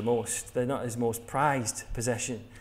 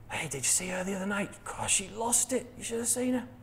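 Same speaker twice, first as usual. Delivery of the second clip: higher, softer, gleeful gossip; gossipy aside; 5 semitones higher, 6 dB quieter than usual. Acted. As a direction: dish the dirt conspiratorially. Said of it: "think about the way, the ways we use it".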